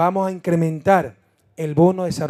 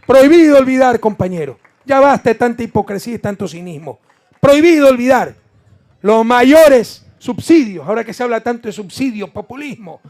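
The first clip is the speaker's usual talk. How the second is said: fiery shout; 6 semitones higher, 11 dB louder than usual. Acted. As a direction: hammer it out at the crowd. Fiery denunciation at rally.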